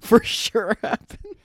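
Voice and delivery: laughing, slow and high. Real, not acted. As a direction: giggle through the words.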